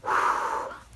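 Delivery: long, shaky emotional sigh; real weary sigh; heavy sigh. Real, not acted. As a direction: let out a deep sigh of feeling. Emotional after finishing book.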